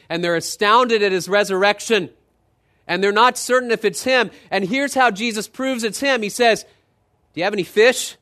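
loud, high, rising sermon delivery; preaching at full force. Acted. Preacher telling the congregation about Jesus, at full force. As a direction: preach it out with mounting fervour.

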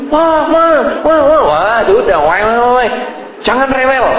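put-on high, whiny toddler wail; fussing child. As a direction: whine like a restless little kid. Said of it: "Anak kecilnya tambah ribut lagi".

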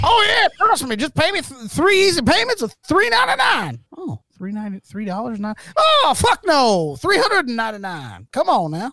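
oversell it like a TV huckster; home shopping host. Pretend. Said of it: "Bargain Bob's pitch".

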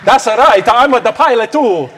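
ordinary delivery

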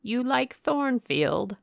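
high, slow witchy croak of a question; mock hag asking sweetly. A narrator's reading. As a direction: lean in like a heath witch, drawn out. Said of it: "a hag like one of those".

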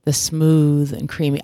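low, slow, savoured echo of a guest; imitation of a smooth, low voice; imitating smooth delivery. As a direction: linger on it like a soul crooner. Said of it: "the way Jermaine said smooth and creamy".

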